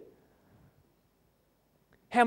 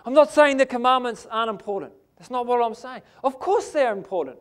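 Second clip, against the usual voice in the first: normal talking voice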